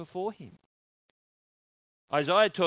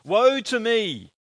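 lament; 7 semitones higher, 8 dB louder, slower than usual. Acted. Isaiah's lament read aloud.

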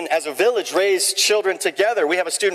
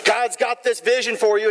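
ordinary delivery

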